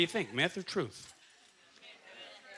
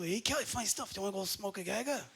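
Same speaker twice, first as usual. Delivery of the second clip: put-on Japanese accent; 8 dB quieter than usual. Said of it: "a couple Japanese guys".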